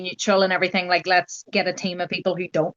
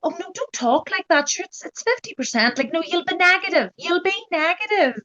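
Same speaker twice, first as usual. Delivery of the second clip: high-pitched, insistent shushing of someone's worries; dismissive reassurance; 9 semitones higher than usual. Retold or imitated. People cutting off her planning ahead, high dismissive reassurance. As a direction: mimic well-meaning people brushing it off, voice way up.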